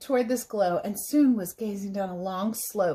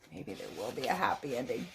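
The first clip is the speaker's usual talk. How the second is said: hopeful aside; 10 semitones lower, 11 dB quieter, faster than usual. Acted.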